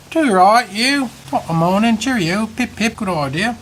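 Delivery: high, loud hammed-up British accent; mock posh British. Mocking English people to his viewers, hammed-up British accent. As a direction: ham up a jolly English gent. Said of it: "I don't know what that was".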